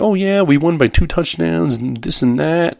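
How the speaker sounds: a hint of a cheerful dad covering up; breezy husband brag.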